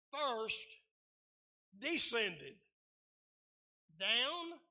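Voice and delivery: deliberate preacher's reading, word by weighty word; measured Bible reading for followers; slow scripture reading. Real, not acted. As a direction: read slowly, with long pauses between phrases. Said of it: "I'm gonna read it slow".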